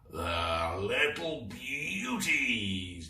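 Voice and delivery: slow, gloating pirate chuckle; chuckling. Acted. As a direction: savour each word with a chuckle. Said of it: "chuckled Captain Claw".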